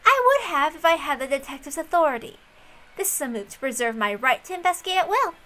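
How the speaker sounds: confident girl explaining her scheme, lightly played; a young detective girl coolly justifying her move, lightly voiced; lightly voiced Erica. Narrator voices Erica replying.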